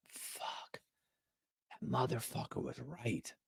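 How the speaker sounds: breathy whispered realization; whispered inner thought. Retold or imitated. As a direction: whisper it as if the thought just landed.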